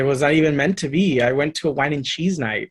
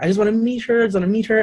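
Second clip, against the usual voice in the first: eager wish; 8 semitones higher, faster than usual. Retold or imitated.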